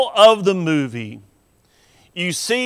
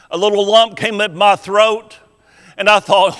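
ordinary delivery

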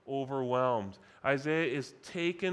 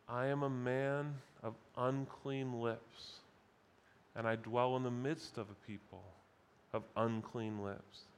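hushed confession; 4 semitones lower, 7 dB quieter than usual. Acted. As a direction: quietly own the guilt, like a shamed man. Isaiah's confession, repeated softly.

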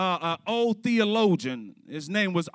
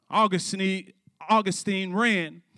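ordinary talk

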